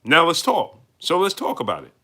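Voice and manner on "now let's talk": normal talking voice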